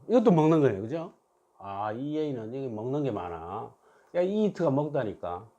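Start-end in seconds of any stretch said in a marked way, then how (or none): none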